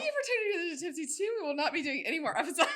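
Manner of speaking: fast, high, laughing outro; giggly loud closing announcement; mock farewell announcement. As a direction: rattle off a pretend sign-off through laughter. Pretend farewell announcement for the show.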